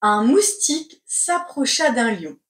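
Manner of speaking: ordinary talk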